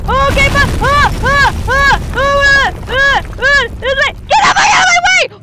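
high, loud, frantic yells building to a shout; panicked screaming. Real, not acted. Streamer fleeing a monster, screaming in panic. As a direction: flee screaming for your life. Chased in horror game.

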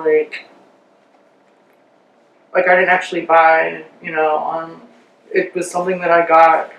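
careful small lip movements; speaking through a face mask; wearing a sheet face mask. Real, not acted.

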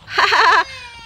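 high burst of laughter; real high-pitched cackling laugh; amused laugh. Real, not acted. Amused by the giant head.

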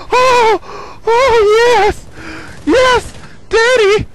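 high, loud victory shrieks; thrilled gamer yelling; excited screaming. Real, not acted. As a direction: scream with real glee at winning. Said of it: "I started screaming a little bit much".

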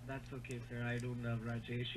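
ordinary talk, nothing marked

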